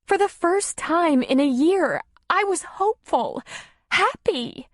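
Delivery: high and tender, close to crying; tearful. A narrator's reading. Darla telling Tom she had hope, tearful. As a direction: say it through a tear. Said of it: "releasing a tear".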